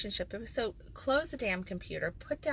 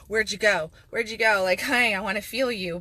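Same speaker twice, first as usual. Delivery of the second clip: louder, pleading call; calling to a distracted partner; 3 semitones higher, 10 dB louder than usual. Speaker calling to a partner who drifted off, louder. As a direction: reach out to pull them back. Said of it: "makes me wanna go".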